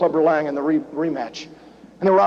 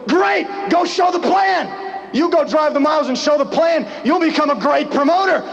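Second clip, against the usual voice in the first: mock pushy shout; 7 semitones higher than usual. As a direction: bark it out like a pushy mentor. Quoting John's pushy urging.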